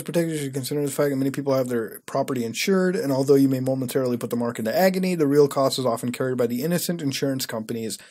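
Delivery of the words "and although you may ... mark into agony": ordinary talk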